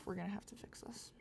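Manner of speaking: breathy whispered aside to herself. Real, not acted. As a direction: mutter it under your breath, voiceless. Whispered aside while drawing.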